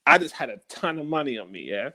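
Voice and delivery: normal talking voice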